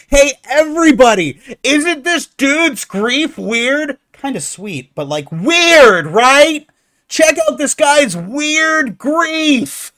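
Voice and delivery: high, loud, slow mocking showman call; hammed-up crowd-rallying mockery; sarcastic barker announcing a freak show; mock announcer.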